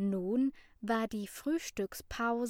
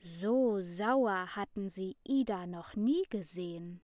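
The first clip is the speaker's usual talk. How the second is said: usual speaking voice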